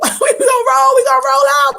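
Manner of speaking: loud, laughing hype shout, pitched high at the start; rally everyone, gleeful; excited shout. Acted. Hyping up the trip with Goddess.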